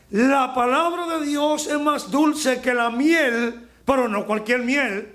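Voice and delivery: preacher soaring on the sweetness of God's word; fervent preaching. Real, not acted. Preacher climbs to a peak.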